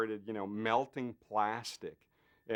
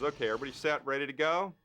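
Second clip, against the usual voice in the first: louder call, rising at the end; John calling out; 5 semitones higher, 4 dB louder, faster than usual. Retold or imitated. Director calling the set to get ready, louder. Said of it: "John says".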